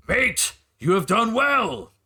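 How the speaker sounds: high, slow put-on voice praising the cook; orc barkeep. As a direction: slowly call praise to the kitchen. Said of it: "he goes".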